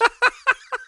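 high, loud burst of laughter. Real, not acted. Friend cracks up at the album ranking, loud laughter.

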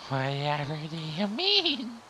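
slow, feigned innocence; mock innocent. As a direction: play dumb, drawn out. Mock innocent reply to murder.

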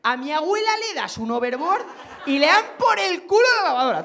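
high, climbing comic outburst; hyped-up exclamation. Acted. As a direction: proclaim it with soaring enthusiasm. Hyped punchline about grandmother's hoverboard.

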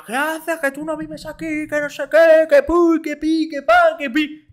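high, shrill-voiced mock old lady nagging; squeaky nagging granny; scolding neighbour lady. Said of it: "y venga".

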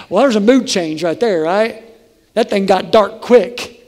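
ordinary delivery

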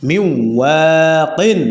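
মিউ Joaquin chanted slowly and drawn out; slow recitation. Shown as a demonstration. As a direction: stretch it like tilawat. Teacher recites the joined word. (ধীরে টেনে তিলাওয়াত)

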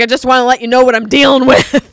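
very loud, fed-up complaint; exasperated shout. Retold or imitated. Friend complaining about the dad's mess, loud exasperation. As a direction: vent it at full volume. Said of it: "He's like".

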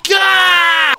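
very high, loud shriek; scream. Real, not acted. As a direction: caught by the monster. Caught by Slender Man.

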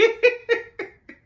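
high burst of laughter; real loud high laughter. Real, not acted. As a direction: really crack up. Laughs at McDonald's excuse.

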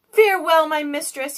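farewell with a slight quiver, starting high. A narrator's reading. Genie bidding his mistress goodbye, slight quiver. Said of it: "with a quiver in his voice".

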